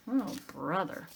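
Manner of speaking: usual speaking voice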